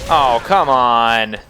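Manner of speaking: loud, drawn-out exasperation; exasperated. Real, not acted. Player protesting an enemy still alive, exasperated. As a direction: groan it out slowly and loudly at the game.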